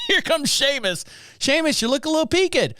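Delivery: mock Irish greeter. Men greet propped-up Seamus, mock high-pitched greeting. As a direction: lift the voice high, hamming up a hello. Acting out propping up dead man.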